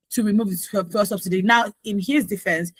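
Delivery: ordinary talk, nothing marked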